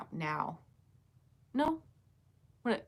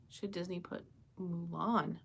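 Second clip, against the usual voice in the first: normal talking voice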